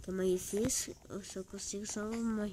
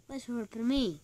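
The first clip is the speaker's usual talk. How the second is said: ordinary delivery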